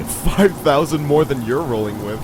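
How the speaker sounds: loud, smug retort; bragging back at a friend; smug comeback. Acted. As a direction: fire back with a grin. Comeback in banter.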